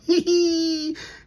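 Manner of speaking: a short high burst of laughter; laughing at his own line. Real, not acted.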